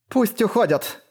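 high, ringing order; ringing, agitated cry. A narrator's reading. Isana stops the pursuit, a ringing anxious cry. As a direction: call out with nerves raising the pitch. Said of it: "таким звонким от волнения голосом".

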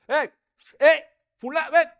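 high, loud call to a passerby. Acted. Boaz calling the unnamed kinsman over, loud hail. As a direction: hail him from across the square.

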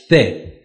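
"سه" with a th sound; th pronunciation demo. Shown as a demonstration. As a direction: say "سه" with th in place of plain s.

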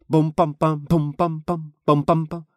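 loud, high string of booms; slow volley of banging noises; imitated barrage. Narrator imitates meteor barrage. (громкая имитация череды ударов)

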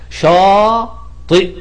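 شاطئ stretched very slowly, hamza clear at the end; slow clear model word. Shown as a demonstration. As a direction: draw out شاطئ syllable by syllable. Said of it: "شاطئ بتتكتب إزاي".

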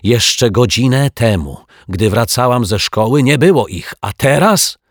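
scandalised complaint rising in pitch; indignant. A narrator's reading. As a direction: protest with mounting outrage. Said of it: "powiedziała z oburzeniem pani Ryczaj".

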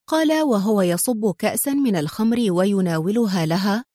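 usual speaking voice

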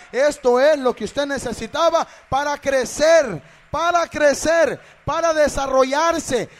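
very loud, high, driving shout; full-force preaching. Real, not acted. Preacher urging believers that pruning makes them grow, full-force preaching. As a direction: hammer each phrase out, shouting and stretching it.

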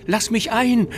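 wailing. Acted. Ghost child wails at the window to be let in, wailing loudly. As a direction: wail it, begging desperately. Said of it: "Immer noch jammerte es".

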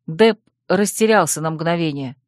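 ordinary delivery

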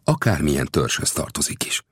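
ordinary delivery, nothing marked

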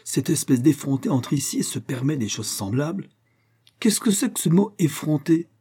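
high-pitched indignant outburst; indignant, pitched well up; angry exclamation. A narrator's reading. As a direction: exclaim it in offended anger. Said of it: "en colère et frappant du poing".

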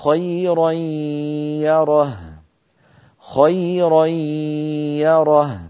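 خيرا يره بتنوين مدغم في الياء بغنة; أدغم تنوين خيرا في الياء; إدغام التنوين بغنة. Shown as a demonstration. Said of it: "ومثال التنوين كما نرى". (إدغام التنوين مع غنة)

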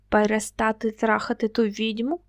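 ordinary delivery

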